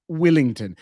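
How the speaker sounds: Wellington said as "Willington"; New Zealand pronunciation. Shown as a demonstration. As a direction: say Wellington the Kiwi way, with a short i first. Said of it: "but in New Zealand, it's Wellington".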